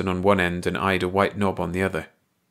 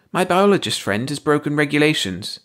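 high alien voice; 7 semitones higher than usual. A narrator's reading. The bald alien keeps speaking.

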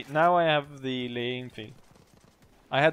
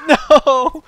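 loud high cry of dismay; anguished yell; 18 dB louder than usual. Real, not acted. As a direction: yell no as you get shot. Killed in the game.

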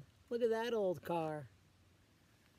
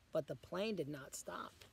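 plain reading voice

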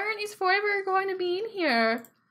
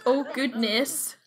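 ordinary talk

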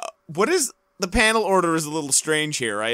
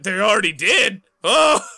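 louder, much higher startled cry; hammed-up gasp of shock; mock shock; 5 semitones higher, 6 dB louder than usual. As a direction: play it as a big surprise.

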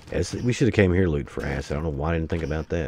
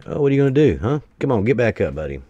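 raised, higher taunting voice; loud taunting; 6 semitones higher, 6 dB louder, faster than usual. Real, not acted. Player taunts a downed enemy, loud and goading. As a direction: goad them loudly to get up.

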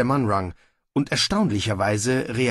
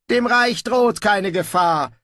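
high, slow, louder self-satisfied reply; smug; 10 semitones higher, 5 dB louder than usual. A narrator's reading. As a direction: dismiss the danger complacently in a high voice. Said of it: "erwiderte der Gebieter selbstgefällig". (selbstgefällig)

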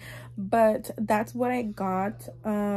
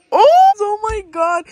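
loud, high exclamation; flustered at her own mistake; embarrassed exclaim; 10 semitones higher, 13 dB louder than usual. Real, not acted. Laughing at her misspelling.

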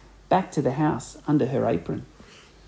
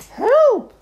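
very high shrieked cry for help; scream; 9 dB louder than usual. A narrator's reading. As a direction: shriek it out in panic. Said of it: "screamed Missus Twit".

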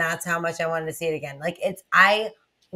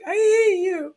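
much higher, louder whine; mock tearful whine; 11 semitones higher, 3 dB louder, slower than usual. Telling her brother off in a tearful, high whine. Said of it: "And I'm like".

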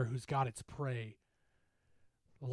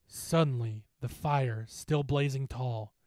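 ordinary delivery, nothing marked

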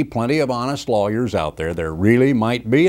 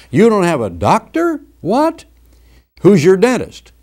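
high, incredulous what; mock-shocked voice of a nosy acquaintance; mock astonishment; 9 semitones higher, 5 dB louder than usual. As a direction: shoot up in disbelief at no doctor. Acted incredulous question.

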